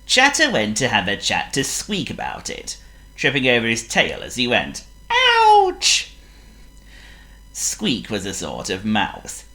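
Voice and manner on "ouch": very high, loud squeaky yelp; shrill cartoon monkey ouch. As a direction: squeal like a hurt little monkey. Trips over tail.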